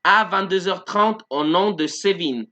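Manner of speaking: slow, careful French sentence read out; slow model sentence. Shown as a demonstration. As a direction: read it slowly for learners. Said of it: "tenemos la última".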